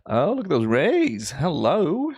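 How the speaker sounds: normal talking voice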